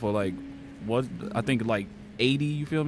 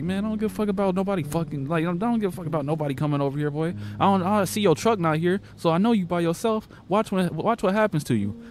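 quoting the stranger, high and menacing; retold menacing threat; 6 semitones higher than usual. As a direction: make the stranger's threat sound dangerous, pitched up. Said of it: "And he was like".

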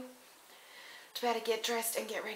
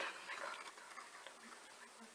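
fading; 15 dB quieter than usual. Real, not acted. Repeating in disbelief, fading.

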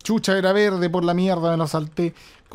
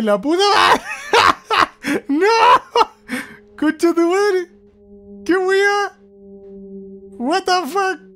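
much higher, much louder screams with laughing and crying; terrified screaming; 7 dB louder, much slower than usual. Real, not acted. Fleeing the killer bird, screaming in terror. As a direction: shriek in real panic, half laughing.

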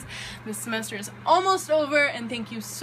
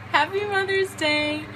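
laughing; 7 semitones higher, 5 dB louder, slower than usual. Real, not acted. Laughs, awkward on camera.

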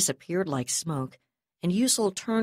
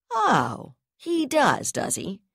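pitched up, mocking disbelief; sarcastic anger; 9 semitones higher, 4 dB louder, slower than usual. A narrator's reading. Brigadier mocks the news of Terekov's call. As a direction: sneer it, high and scornful. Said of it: "felt her lips twist in anger".

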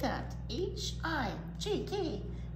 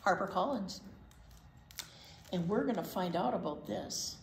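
ordinary delivery

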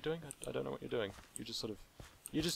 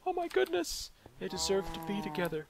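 squealing mock delight, much higher; mock overjoyed fans; 10 semitones higher than usual. Narrator mimics fans swooning over iron, squealing much higher. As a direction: gush like a swooning fan. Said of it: "and everyone's like".

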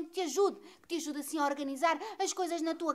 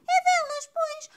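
much higher, pointed; emphatic; 11 semitones higher, 4 dB louder, slower than usual. Acted. Psychologist reassuring Santa, high pointed emphasis. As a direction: squeak it out knowingly.

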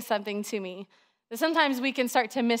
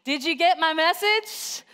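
raised pitch, rallying call-out; leading the crowd; 6 semitones higher than usual. Shown as a demonstration. Preacher leading the congregation in the title, moderately raised. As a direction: prompt everyone to chant along, moderately lifted. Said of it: "Everybody say that with me".

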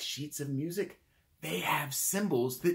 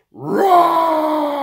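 huge, high, long roar; dinosaur roar; 14 semitones higher, 16 dB louder than usual. Shown as a demonstration. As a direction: roar at full volume like a dinosaur. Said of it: "think of a dinosaur".

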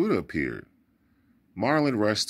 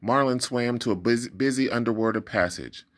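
ordinary delivery

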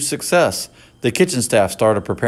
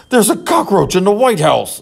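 higher, alarmed presidential shout; 9 semitones higher, 4 dB louder than usual. A narrator's reading. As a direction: raise the alarm loudly. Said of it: "the President shouted".

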